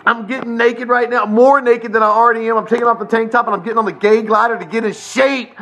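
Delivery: high, loud, laughing shouts; wild frenzy. Real, not acted. Host signs off working himself into a frenzy, whooping. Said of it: "work myself into a frenzy".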